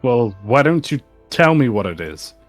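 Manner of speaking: ordinary talk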